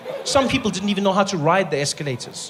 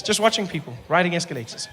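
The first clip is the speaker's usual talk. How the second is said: usual speaking voice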